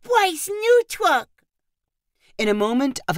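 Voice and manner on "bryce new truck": put-on high, slow toddler delight; little boy thrilled with his new truck; excited toddler. A narrator's reading. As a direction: savor it like a happy little boy. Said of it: "nodded excitedly as he said".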